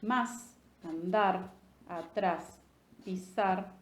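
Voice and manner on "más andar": usual speaking voice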